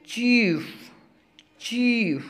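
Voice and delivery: चीफ with a long drawn ee; long ee demo. Shown as a demonstration. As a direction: stretch the ee in चीफ. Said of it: "बड़ी मात्रा लग रही है".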